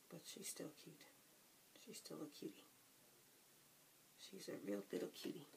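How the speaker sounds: soft unvoiced whisper. Real, not acted. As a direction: whisper it close to the canvas. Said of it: "whispering while painting detail".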